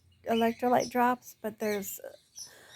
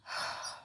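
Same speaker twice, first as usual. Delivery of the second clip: long tired exhale; weary sigh; frustrated sigh; 10 dB quieter than usual. Real, not acted.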